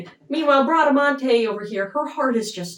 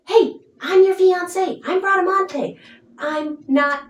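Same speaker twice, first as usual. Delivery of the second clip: higher, insistent retold plea; upset reveal; 7 semitones higher, 4 dB louder than usual. Upset fiancée reveals herself to him, high insistent plea. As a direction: act out urgently setting someone straight. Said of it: "she's so upset by this".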